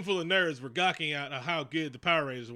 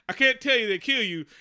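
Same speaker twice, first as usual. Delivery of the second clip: higher, louder mock panic; hammed-up frightened cop; scared cop; 7 semitones higher, 8 dB louder than usual. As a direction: plead in fake fear. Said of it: "And he's like".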